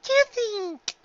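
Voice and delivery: put-on very high child voice, shouted; a little filly cheering for mom; young filly shouting. A narrator's reading. Daughter cheering her mother's birthday, very high child voice.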